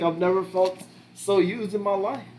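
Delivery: put-on Filipino accent. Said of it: "Terrible Filipino accent".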